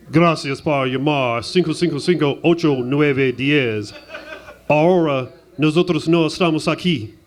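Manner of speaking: put-on Spanish accent. Grandpa dodging a bill collector, fake Spanish accent. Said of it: "slapped that Spanish accent on ya".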